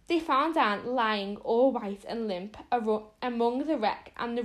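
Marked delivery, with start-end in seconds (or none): none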